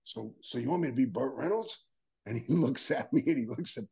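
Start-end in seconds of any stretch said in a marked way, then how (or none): 0.0-1.9 mock disbelief, softer; quietly echoing in disbelief; incredulous. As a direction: repeat it back half-stunned. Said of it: "So I'm going".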